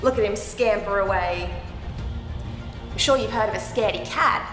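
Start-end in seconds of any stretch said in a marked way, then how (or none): none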